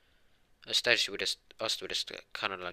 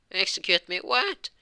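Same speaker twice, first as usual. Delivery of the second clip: high, loud shocked yelp; shocked disbelief; 11 semitones higher, 7 dB louder than usual. Acted. Boy told he'll be executed, shocked high yelp. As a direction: squeak it out in outraged disbelief.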